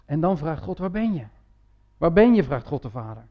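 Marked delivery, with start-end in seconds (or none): 0.8-1.2 a quiet first call of Waar ben je, no push; soft call. Retold or imitated. God softly calling the hiding man, quiet. 2.0-2.4 Waar ben je called out with more force; louder call. Retold or imitated. God calling the hiding man again, louder.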